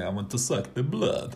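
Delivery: a half-hearted hint of vampire; mock vampire. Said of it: "have to do an impression".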